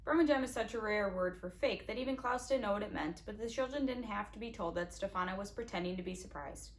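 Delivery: ordinary delivery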